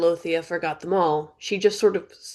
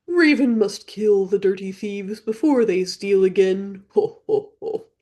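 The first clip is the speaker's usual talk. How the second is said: slow, louder bird voice ending in a ho ho ho; raven threat; 2 semitones higher, 4 dB louder than usual. Acted. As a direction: croak the threat then cackle.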